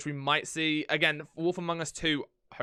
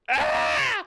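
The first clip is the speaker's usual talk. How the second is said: high squeal of delight; excited shriek; 5 dB louder than usual. Retold or imitated. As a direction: shriek with sudden glee. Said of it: "I'll be like".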